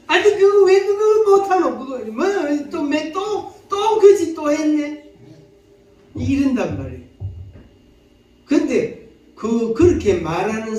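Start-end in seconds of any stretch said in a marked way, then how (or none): 0.1-5.1 high-pitched, exasperated nagging; exasperated scolding. Acted. Preacher acts out nagging someone who failed, exasperated scolding. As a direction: scold in a much higher voice.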